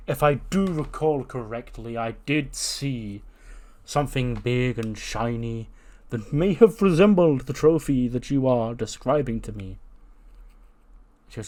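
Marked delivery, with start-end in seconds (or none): 0.1-9.8 slow, pompous recollection; ponderous, over-formal storytelling voice; formal janitor. Acted. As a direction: draw it out with elaborate courtesy. Voices the formal janitor Keith Lee.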